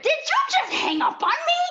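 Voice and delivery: high, loud indignant mom voice; outraged mom. Retold or imitated. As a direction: shriek it like an outraged mother. Mom calls back outraged.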